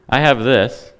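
ordinary delivery

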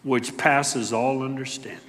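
ordinary talk, nothing marked

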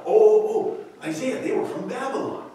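high, eager self-satisfied reply; proud king. Acted. Hezekiah answering the prophet, pleased and proud. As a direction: boast without noticing the trap. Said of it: "as if he's proud".

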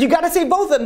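very high, frantic insistence; acted desperate demand. Husband begs doctor to save both, desperate.

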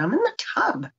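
high-pitched retold protest; retold calling out. Wife answering husband through the bathroom door, high protest. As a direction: call back in a high, put-out voice.

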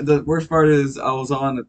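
normal talking voice

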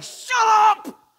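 high, loud mock yell; exasperated shout. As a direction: shout down the annoying classmate. Classmates snapping at the annoying kid.

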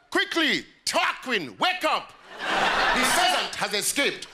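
high, urgent mock-upper-class alarm; Oxford dad. Oxford dad waking his son, mock alarm. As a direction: rouse the son in a high, panicked put-on voice. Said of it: "one morning in Oxford and your dad goes".

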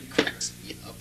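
breathy words at the end of a laugh; whispered through laughter. Real, not acted. Host still cracking up at the quote, whispered laugh.

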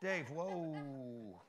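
low, soft, drawn-out whoa; slow down the daughter's date; dad quietly halting a suitor; wary father. Acted. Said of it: "that is dating your daughter".

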